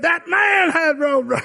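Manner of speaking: high, emphatic retort on THAT MAN; insistent shout. Retold or imitated. Charles insisting to the preacher who had road rage, insistent shout. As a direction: shout the accusation back indignantly.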